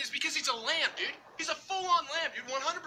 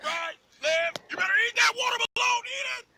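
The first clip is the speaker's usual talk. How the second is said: coach yelling; 5 semitones higher than usual. Acted.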